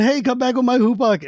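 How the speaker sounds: high mock call after the staff. Taz calls after his stolen staff, high mock shout. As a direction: shout after the thief, half-playful.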